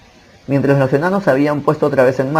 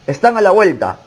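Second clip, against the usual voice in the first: higher-pitched, urgent narrated cry; wizard's warning shout; 6 semitones higher than usual. A narrator's reading. Wizard warning his companions of pursuers, restrained shout. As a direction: call out a mildly raised warning. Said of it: "gritó el mago".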